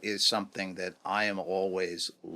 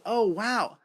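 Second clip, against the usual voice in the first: high-pitched reenacted wonder; impressed amazement; 13 semitones higher, 4 dB louder than usual. Executive meeting a fellow rose lover, impressed amazement. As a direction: act out being pleasantly amazed.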